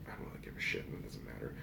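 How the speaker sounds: breathy unvoiced whisper. Shown as a demonstration. Vlogger whispering his shrug to viewers. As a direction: drop to a whisper for the aside.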